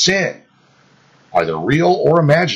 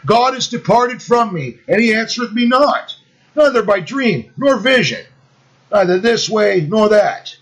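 anguished cry; 8 semitones higher than usual. Acted. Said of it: "constrained to cry out".

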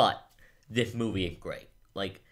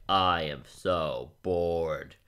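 slow, lower bored complaint; retold boredom; 4 semitones lower, much slower than usual. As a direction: drag it out as if bored stiff. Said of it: "but it's so boring".

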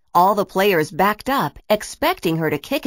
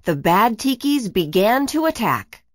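usual speaking voice

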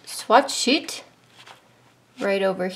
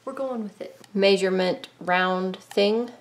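usual speaking voice

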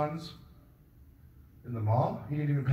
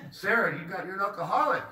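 scolding Sarah; 7 semitones higher than usual. Acted. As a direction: tell Sarah off, raising the voice. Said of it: "One of those sounds like".

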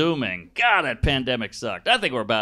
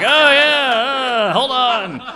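awkward fumbling; 10 semitones higher, 7 dB louder, much slower than usual. Retold or imitated. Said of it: "you're like".

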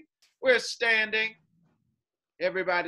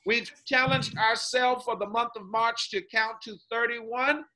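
ordinary talk

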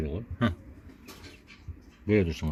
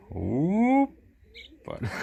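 playful hoppala; 7 semitones higher, much slower than usual. Pretend. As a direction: stretch it out like lifting a baby. Lifting the baby animal up.

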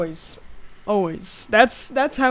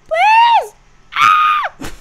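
very high, loud desperate shriek; panicked scream; 9 dB louder than usual. Real, not acted. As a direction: scream for mercy in real fright. Hiding from monster in game.

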